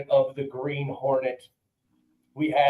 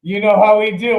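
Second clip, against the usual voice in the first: louder, hyped repeat; emphatic; 5 semitones higher, 7 dB louder than usual. Acted. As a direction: punch it up for the boys.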